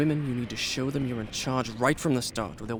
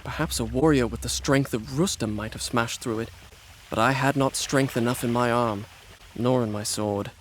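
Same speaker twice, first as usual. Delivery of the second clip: story narration. A narrator's reading. Prince narrates his tale.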